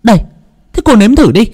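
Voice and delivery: shrill mother-in-law. A narrator's reading. Mother-in-law orders the daughter-in-law to taste the soup, scornful. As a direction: push the spoon at her with contempt.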